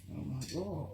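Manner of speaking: ordinary talk, nothing marked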